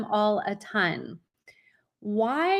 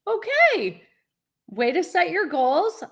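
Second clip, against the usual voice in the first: taken aback okay; 6 semitones higher, 5 dB louder than usual. Retold or imitated. Mom reacting to Ivy plan.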